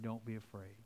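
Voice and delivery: hushed Jesus comforting a grieving father; tender reassurance. Retold or imitated. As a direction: say it softly, eye to eye. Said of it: "picture him looking in his eyes and saying".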